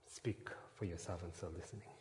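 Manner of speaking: whispered plea; unvoiced prayer whisper. Real, not acted. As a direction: breathe the words to God.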